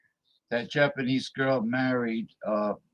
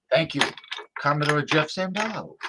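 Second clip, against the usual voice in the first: ordinary delivery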